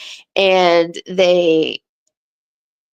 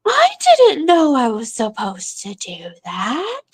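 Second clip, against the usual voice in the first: mock innocent kid; 6 semitones higher than usual. Kids feign ignorance to mom.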